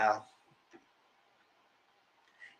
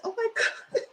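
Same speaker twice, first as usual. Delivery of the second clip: mock Tisha gasp. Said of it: "that she said".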